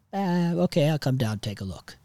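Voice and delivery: a hint of dad's voice at the start; dad impression. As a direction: imitate dad agreeing, pitch up then settling. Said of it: "I can mimic his voice".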